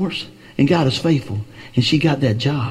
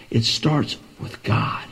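softer, slow breathy hush; hushed aside; 6 dB quieter, slower than usual. Real, not acted. Preacher hushing a key point to the congregation, slow whisper. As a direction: let it fall to a hush.

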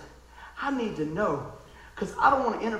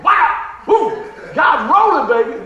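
much louder and higher whoops; joyful shout; 8 semitones higher, 13 dB louder, slower than usual. Real, not acted. Pastor celebrating with his buddy, loud joyful whooping.

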